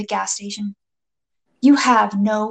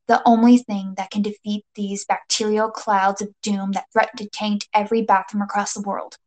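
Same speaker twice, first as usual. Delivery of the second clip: plain reading voice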